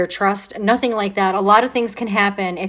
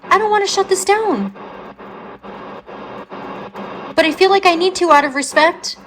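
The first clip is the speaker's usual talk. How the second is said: excited; 8 semitones higher than usual. Real, not acted. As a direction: talk to the spirit all worked up. Reacting to spirit box crying.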